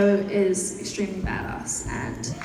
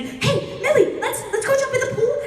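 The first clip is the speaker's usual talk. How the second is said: Noah; 13 semitones higher than usual. Acted. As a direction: imitate a hyper friend, pitched way up. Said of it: "to me, he's just crazy".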